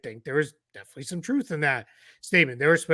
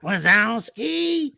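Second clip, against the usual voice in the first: much higher, very slow drawn-out Roz voice; Roz impression; 8 semitones higher, much slower than usual. Podcaster imitates Roz from Monsters Inc. calling out, slow and high. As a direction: call Wazowski slowly, high and nasal-free but raspy-free, as the grumpy clerk. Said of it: "That's probably my best one".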